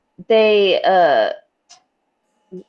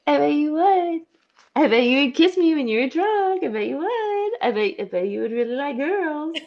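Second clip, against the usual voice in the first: put-on high, sing-song teasing come-on in an Australian accent; flirty teasing voice; 9 semitones higher than usual. Podcaster voicing her flirty comment to Jill, high and teasing. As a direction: coax her like a cheeky flirt.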